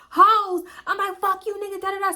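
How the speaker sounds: cursing at passersby, shrill; yelling back. Retold or imitated. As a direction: snap back at the hecklers.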